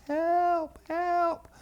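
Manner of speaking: thin high cries from far away; little distant voices. Retold or imitated. Daddy hears his boys from the driveway, distant cries. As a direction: call for help as if heard from afar. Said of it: "these little voices off in the distance".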